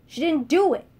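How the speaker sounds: insistent shout. Acted. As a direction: fire it back at once. Beatrice repeats her defence.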